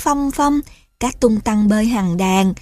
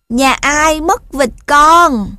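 high, loud, slow goat; bleating goat call; 5 semitones higher, 6 dB louder, slower than usual. A narrator's reading. Goat asks who lost a duckling, bleating call. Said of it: "cũng be be lên kêu".

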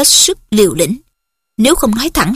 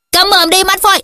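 sharp shout; 9 semitones higher than usual. Acted. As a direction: bark it at him. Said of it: "Hermione đanh giọng quát".

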